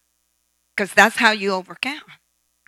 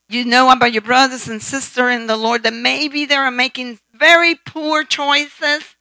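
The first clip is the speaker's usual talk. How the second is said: usual speaking voice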